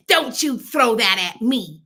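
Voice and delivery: an imitated clerk talking down to a customer; rude cashier's snap. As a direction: sneer it at the customer, slowly and loudly. Rude cashier snaps at speaker.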